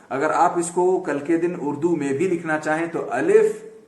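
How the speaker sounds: ordinary delivery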